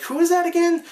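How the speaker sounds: high-pitched mock-puzzled question; retold puzzlement. Reviewer recalling not recognising Hugh Jackman's voice, puzzled.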